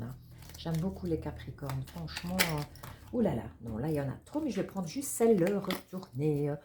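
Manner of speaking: ordinary talk, nothing marked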